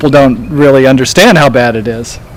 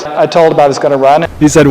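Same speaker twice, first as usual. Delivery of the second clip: ordinary talk, nothing marked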